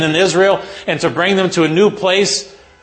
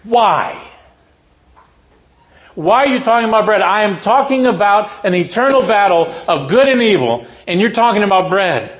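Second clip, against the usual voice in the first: exasperated rebuke; 5 semitones higher than usual. Retold or imitated. Jesus rebukes disciples.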